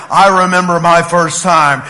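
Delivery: lightly voiced old man from a commercial; old guy. Old guy in a candy commercial, lightly imitated reminiscing. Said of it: "there was this old guy on there".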